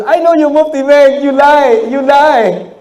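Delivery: high, loud insistent accusing voice; woman accusing him. Retold or imitated. Lady at the airport insisting he's Mufti Menk. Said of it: "She goes".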